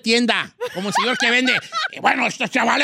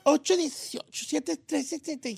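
Don Cheto voice; 4 semitones higher, 7 dB quieter than usual. Acted. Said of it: "¿por qué lo dices así".

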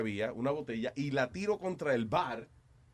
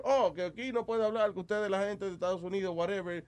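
lightly voiced French manager; higher voice with a hint of French; French accent; 8 semitones higher than usual. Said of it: "el tipo como tiene acento francés". (acento francés ligero)

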